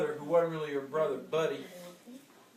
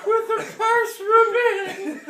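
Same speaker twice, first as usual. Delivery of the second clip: put-on child pleading; 11 dB louder, much slower than usual. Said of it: "And I said".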